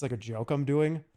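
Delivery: ordinary delivery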